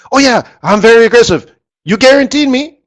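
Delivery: hammed-up investor imitation; mocking investor. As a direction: parody the investor's smugness.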